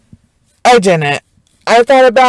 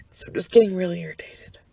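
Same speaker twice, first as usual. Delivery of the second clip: fast, breathy whispered aside; whispering that the irritation is building; irritated whisper; 12 dB quieter, faster than usual. Real, not acted. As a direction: hiss it under your breath, fed up.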